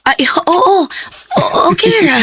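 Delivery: high, flustered yes. Acted. Flustered woman assuring her suitor she's fine. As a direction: fumble the answer, caught off guard.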